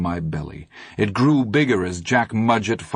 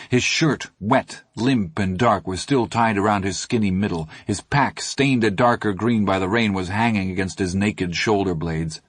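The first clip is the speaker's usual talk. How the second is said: plain reading voice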